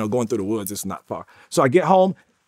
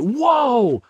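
high, drawn-out amazed whoa; surprised whoa; 9 semitones higher, 4 dB louder than usual. Retold or imitated. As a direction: gasp it out in wonder. Surprised at his paycheck.

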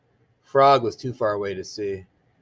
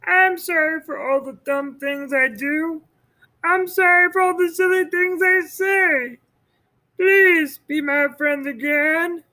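pleading Toad. Acted. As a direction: beg forgiveness in a high voice. Toad apologizes.

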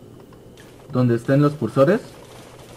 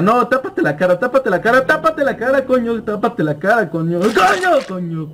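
higher, frantic repeated pleas; pleading in fear; 8 semitones higher than usual. Real, not acted. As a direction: beg it to cover its face. Suplica a la figura aterradora. (súplica asustada y aguda)

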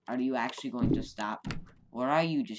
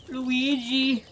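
high, loud desperate plea; pleading; 11 semitones higher, 7 dB louder, slower than usual. Acted. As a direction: beg for mercy. Begging Luigi not to attack.

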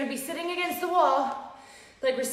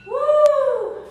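high, loud cheer of relief; excited whoop; 12 semitones higher, 9 dB louder than usual. Real, not acted. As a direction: whoop at the finish. Workout finished cheer.

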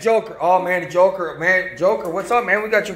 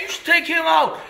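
ordinary talk, nothing marked

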